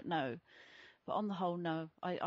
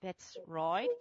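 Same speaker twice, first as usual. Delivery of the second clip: broad mock Aussie with a rising end; silly Australian accent; 4 dB louder than usual. Co-host playing along about being born in Sydney, mock Australian accent. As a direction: exaggerate a fake Australian accent. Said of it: "she did her silly accent".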